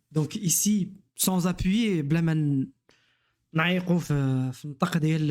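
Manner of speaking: usual speaking voice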